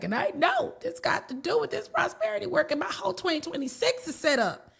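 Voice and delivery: client's high excited voice. Retold or imitated. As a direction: client thrilled about her prosperity working, voiced high and excited. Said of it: "And she was like".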